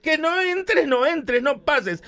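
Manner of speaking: high, slow frantic shout. Acted. Centurion shouting at Jesus to stay out. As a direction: yell at him not to come in. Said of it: "el centurión grita".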